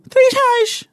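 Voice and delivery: very high put-on voice for "três reais"; high silly imitation of the meme; catchphrase voice. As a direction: squeak the catchphrase. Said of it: "o vício era o".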